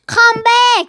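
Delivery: loud high call to come back; shouting after her brother; shouted. Acted. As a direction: yell after him across the beach.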